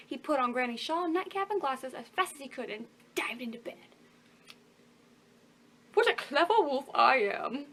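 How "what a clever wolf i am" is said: chuckling. Acted. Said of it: "he chuckled to himself".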